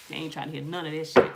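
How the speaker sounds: ordinary talk, nothing marked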